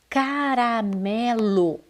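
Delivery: "Caramelo" drawn out slowly, syllable by syllable; slow, connected sounding-out of "Caramelo"; slow model reading. Shown as a demonstration. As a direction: stretch out "Caramelo" for pupils. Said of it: "Faça a leitura".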